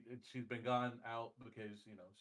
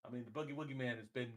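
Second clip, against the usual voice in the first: ordinary delivery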